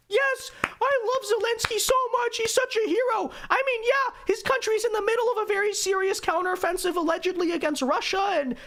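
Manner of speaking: squeaky high-pitched mocking falsetto; mock gushing liberal. Commentator parodying liberals fawning over a president, mock gushing. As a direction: put on a very high, fawning fan voice. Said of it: "the liberals all saying".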